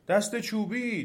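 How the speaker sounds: high, urgent call; trees crying out. A narrator's reading.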